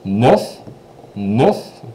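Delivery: "Neuf" with the final f barely touched; French nine, "Neuf" rising with a light f; soft F neuf. Shown as a demonstration. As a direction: let the f of "Neuf" fade lightly. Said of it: "you barely touched the V".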